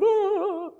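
high-pitched mock wail; imitated crying. Father imitating his upset daughter, mock crying wail. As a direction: imitate a child bawling.

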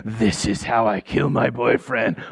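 slow, low mock villain line; mock sinister. Girlfriend joked as plotting murder, lightly mock sinister. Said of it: "She wasn't like".

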